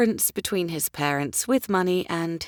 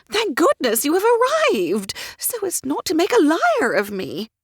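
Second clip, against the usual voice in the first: high, excitable lady's voice; fussy society mother; 8 semitones higher, 5 dB louder than usual. A narrator's reading. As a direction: chatter like a flighty mother of the ton.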